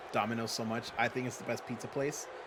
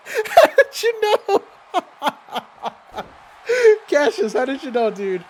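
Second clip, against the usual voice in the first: laughing; 14 dB louder, slower than usual. Real, not acted. Caught out by chat, laughing.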